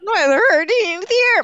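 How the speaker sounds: high, drawn-out playful wail; mock outcry. Co-host protesting over favorite Disney movie, mock outcry. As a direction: cry it out slowly in hammed-up protest.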